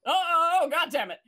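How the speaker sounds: loud, high panicked cry of alarm; panicked yelp. Acted. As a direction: yelp it out in sudden panic as the ship lurches. Dickie panics while attuning to ship.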